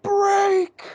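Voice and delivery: high, loud movie-warrior yell; imitated yell. Impersonating the guy yelling at the rock, high shout. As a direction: yell it high, mimicking the film. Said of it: "Yell at it".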